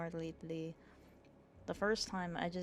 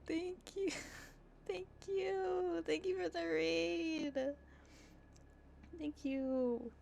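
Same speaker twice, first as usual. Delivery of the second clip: high, thrilled, breathless thanks; excited squeal; 11 semitones higher than usual. Real, not acted. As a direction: gush with delight, squeaky high. Thanking a surprise raid.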